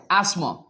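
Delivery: asthma with a plain s and silent th; British asthma. Shown as a demonstration. Teacher demonstrating British asma with s. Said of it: "इसे हम स बोलेंगे".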